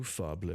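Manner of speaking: Fable pronounced "FAH-bluh"; mock mispronunciation. Shown as a demonstration. Host repeating rumor of a new game, Fable said "FAH-bluh". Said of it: "It's pronounced Fable".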